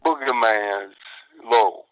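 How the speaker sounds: low, slow, drawn-out bluesy murmur; real slow, real low. Shown as a demonstration. Wrestler demonstrating his surprise interview, real slow and low. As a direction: drop it down low and leave long pauses. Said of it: "I'm talking real slow, real low".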